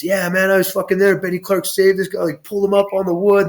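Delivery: high-pitched, worked-up bragging; storyteller at the fire hyped up, pitched high; going off. Retold or imitated. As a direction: rave about it like you were there. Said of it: "he's going off".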